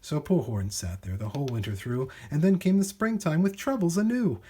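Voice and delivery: plain reading voice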